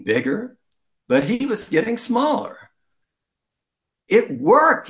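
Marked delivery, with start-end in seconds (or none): 4.1-4.9 high, loud burst of triumph; shouted. Acted. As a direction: shout it out in delight. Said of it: "he shouted to Bart".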